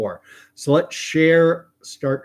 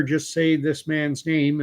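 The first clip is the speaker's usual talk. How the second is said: usual speaking voice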